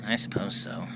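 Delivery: deep, dry ventriloquist dummy voice; a lugubrious puppet, grudgingly agreeing; very low, reluctant dummy. Acted. Ventriloquist dummy Maxie answers.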